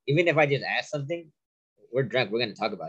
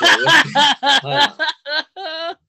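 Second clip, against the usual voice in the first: high, breaking into laughter; laughing; 8 dB louder, much slower than usual. Real, not acted. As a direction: laugh through the words. Laughing at being scared.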